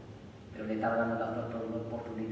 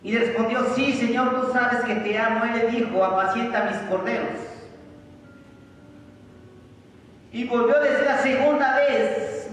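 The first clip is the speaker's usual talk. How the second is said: loud, high preaching voice, reading the scripture aloud; full-force preaching; 9 semitones higher, 10 dB louder than usual. Acted. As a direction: proclaim the verses with all your strength. Preacher reads Gospel at full force. (predicación con toda la fuerza)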